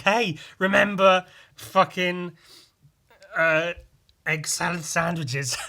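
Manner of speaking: loud, eager callback voice; a slow, loud, clueless nudge; awkward friend. Retold or imitated.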